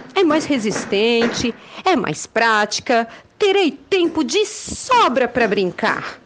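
lively storybook narration; reading to children. A narrator's reading. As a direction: tell it like a bedtime tale.

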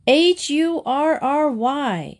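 slow, high, letter by letter; drawn-out, exasperated spelling. A narrator's reading. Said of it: "she spelled it out".

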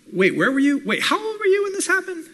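keen listener firing eager questions, high-pitched. Shown as a demonstration. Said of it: "you ask clarifying questions".

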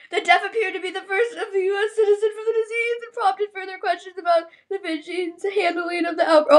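reading the headline through tears; tearful news reading. Real, not acted. Reading the virus news to viewers, tearful. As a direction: read it aloud, voice wobbling.

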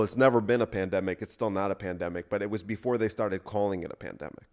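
normal talking voice

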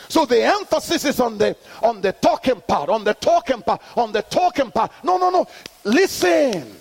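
high, ringing call-and-response preaching; full-force preaching. Acted. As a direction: drive the congregation to answer back. Preaching to congregation.

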